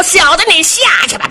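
high, quick cry while flinging someone; triumphant heave. Acted. Shi Danai throwing Jin Jia off stage, triumphant cry. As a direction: toss him off with a high-pitched yell. 史达奈把人扔下台.

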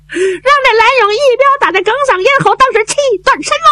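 high, tearful pleading, comforting between sobs; crying while consoling. Acted. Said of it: "她一边哭， 她一边还劝".

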